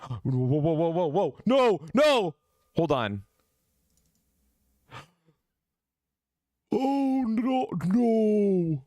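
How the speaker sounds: rising whoas into high cries of no; shocked alarm. Real, not acted. Discovers her song is gone.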